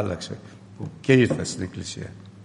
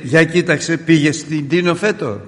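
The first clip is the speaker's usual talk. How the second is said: high, loud mocking quote; goading cousin; 6 semitones higher, 8 dB louder, slower than usual. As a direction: needle the aunt loudly. Cousin goads the mother. (ο ξάδερφος πειράζει τη θεία με δυνατή ψηλή φωνή)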